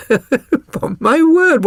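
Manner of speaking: a chuckle rolling into the words, slow and high; chuckling. Acted. Holmes chuckling as he praises Watson. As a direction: laugh it out, amused and pleased.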